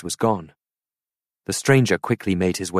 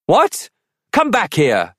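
high, louder yell of protest; 11 semitones higher, 6 dB louder, slower than usual. A narrator's reading. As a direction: shout after the thief. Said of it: "yelled Arthur".

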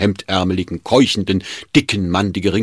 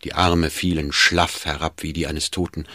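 ordinary delivery, nothing marked

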